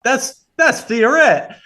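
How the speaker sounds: high, slow, drawn-out disbelief; stunned realization. Retold or imitated. Spotting his old trade partner caddying, stunned disbelief. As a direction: recognize him and can't believe it. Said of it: "I kinda said to myself".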